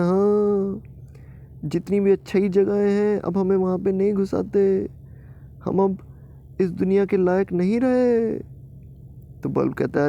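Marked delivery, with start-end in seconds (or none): 0.0-8.4 mock weeping. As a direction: cry that they are useless now. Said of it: "इसलिए रो रहे हो तुम दोनों?". (दुखी रोती हुई आवाज़)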